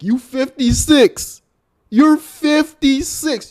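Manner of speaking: high, loud and slow, in disbelief; exasperated. Real, not acted. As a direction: drag out the age in loud disbelief. Exasperated scolding of Unc.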